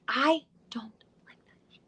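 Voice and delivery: a confiding whisper; whispered aside. Real, not acted. Host confiding her dislike of conventions in a whisper. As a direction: drop to a whisper for the curse. Complaining about BravoCon panels.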